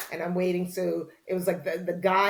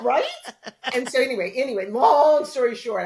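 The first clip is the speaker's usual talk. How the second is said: laughing and louder; 6 semitones higher, 7 dB louder than usual. Real, not acted. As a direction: laugh it off and move on. Laughs, then pushes on.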